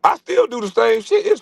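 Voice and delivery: high gleeful claim; excited boast. Real, not acted. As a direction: brag with glee. Emphatic boast.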